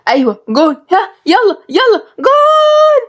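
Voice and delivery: building to a very high, drawn-out yell; excited cheering. Acted. Shorouq willing Egypt to score, excited screaming. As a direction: cheer upward into a long squeal.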